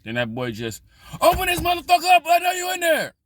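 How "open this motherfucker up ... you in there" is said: high, loud furious yell to open up; bang-on-the-door shout, much higher and louder; yelling through the door. Retold or imitated. Old barber yelling at the speaker through the door, shouting.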